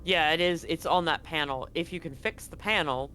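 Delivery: usual speaking voice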